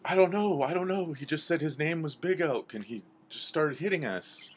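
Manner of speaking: high, loud, frantic babble; alarmed little-creature voice, easing slightly at the end; panicked animals. Acted. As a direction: protest in a shrieky panic. Frightened animals react to attack.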